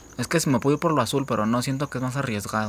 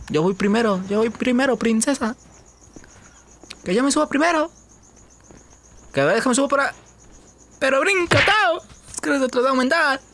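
much higher, playful put-on voice; high put-on voice teasing his partner Tao; high mock voice; 11 semitones higher than usual. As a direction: tease her in a high squeaky voice. Teasing his in-game girlfriend Tao.

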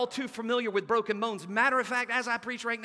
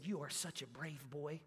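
much softer, tender praise; 8 semitones lower, 16 dB quieter than usual. Retold or imitated. Secretary praising the boy, soft and tender. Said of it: "She looked at him and said".

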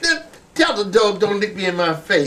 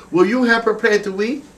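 ordinary talk

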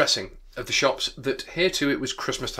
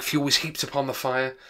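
ordinary delivery

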